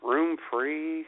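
normal talking voice

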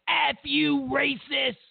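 high yelled insult, hammed up; mock shout. Mocking liberals, he yells the insult high and loud. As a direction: yell it like an outraged mob. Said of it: "They'd be like".

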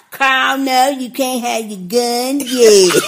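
slow, drawn-out mocking whine; mock whining. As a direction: mimic a whiny, fretting parent. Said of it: "And whining about".

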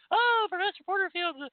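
shrieking accuser, hammed-up; mock hysterical critic. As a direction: squeal it like a scandalised critic. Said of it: "run off and scream and yell".

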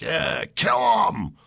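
high, slow drawn-out cheer of a rowdy fan; a hammed-up sports fan roaring for blood; mock crowd shout. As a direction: yell it like a fan in the stands. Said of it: "We're all like".

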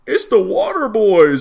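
high, loud, slow jeering shout; loud mock greeting. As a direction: yell it like a goofy heckler. Large guy loudly greets them.

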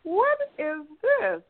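high, drawn-out puzzled question. Retold or imitated. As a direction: imitate someone slowly trying to figure it out. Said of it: "he was trying to figure out".

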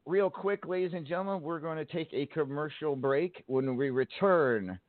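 high, bright announcer call; radio announcer. Acted. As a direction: announce the break like a radio host. Radio host announcing break.

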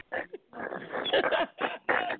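high airy laugh; breathy laugh. Real, not acted. Laughs at the yell.

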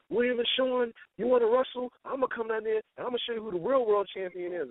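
a dad at the basement door threatening to come down; warning dad. Retold or imitated. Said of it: "He'd be like".

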